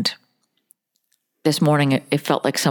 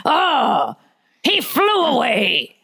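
high, slow pirate lament; mock pirate; 9 semitones higher, slower than usual. Pirate lost parrot and doubloons, high drawn-out wail. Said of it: "now he's a pirate".

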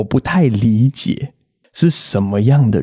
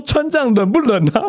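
nosy doorman; 11 semitones higher than usual. Retold or imitated. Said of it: "他就是问我说".

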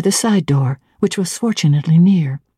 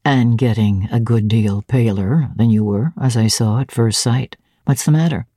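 a hint of a lower, stern master; lightly voiced Rochester; 6 semitones lower than usual. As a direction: drop a little lower for the gruff master.

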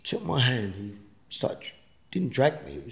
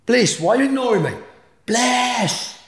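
much higher, louder desperate call; 13 semitones higher, 7 dB louder than usual. Retold or imitated. Ghost calling to his wife who ignores him, loud higher call. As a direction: shout her name, hurt she won't answer.

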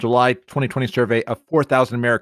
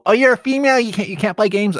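higher-pitched mimicry of a dismissive gamer; mocking stigma voice; 8 semitones higher than usual. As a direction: parrot the stigma in a raised pitch. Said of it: "that stigma".

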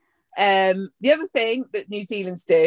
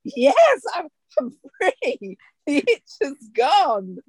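high, drawn-out cry of relief; slow, jubilant declaration of freedom, higher voice; milk the relief, pitched up and stretched; liberated horse; 5 semitones higher, slower than usual. Acted. Voicing a horse freed of itch.

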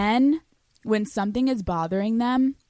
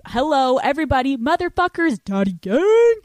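calling out; 5 semitones higher, 6 dB louder than usual. Real, not acted. Calling out to audience.

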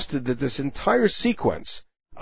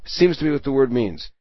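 normal talking voice

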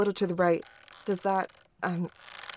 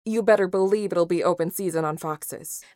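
normal talking voice

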